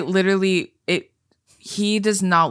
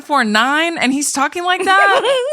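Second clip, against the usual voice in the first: higher, laughing through the words; squealing incredulous laugh; laughing disbelief; 9 semitones higher, 4 dB louder than usual. Real, not acted. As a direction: crack up while ranting. Laughing disbelief about his accent.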